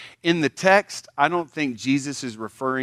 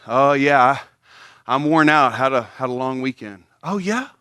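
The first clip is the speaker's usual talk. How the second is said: louder, hammed-up humblebrag; humblebragging churchgoer; 3 semitones higher, 9 dB louder than usual. Churchgoer humblebragging about serving at church, hammed-up. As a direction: drop your good deeds casually but loudly. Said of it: "blow the horn and say stuff like".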